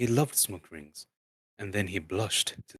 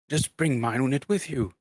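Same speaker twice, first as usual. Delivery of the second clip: Thorin voiced; 5 semitones higher, 5 dB louder than usual.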